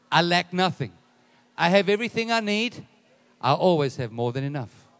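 loud, emphatic separate statements; preacher's declaration. Acted. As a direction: lead the crowd phrase by phrase, loudly. Leads congregation in a declaration.

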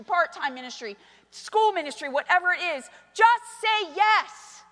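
rousing full-force pulpit call; preaching. Acted.

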